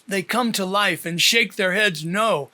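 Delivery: usual speaking voice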